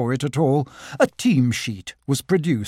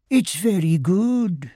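a small timid voice, lightly done; Henry Rush the beetle; 3 semitones higher, slower than usual. Acted. Beetle asking Christopher Robin about scoring, small and slow. Beetle admits his adding.

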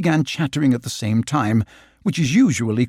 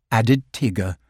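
usual speaking voice

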